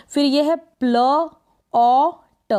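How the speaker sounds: Plot stretched slowly as pla-ot; teacher slowly drawing out plot; slow, drawn out. Shown as a demonstration. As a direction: drag out plot slowly for the class. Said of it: "इसको बोलेंगे plot".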